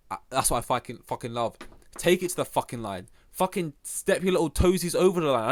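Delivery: normal talking voice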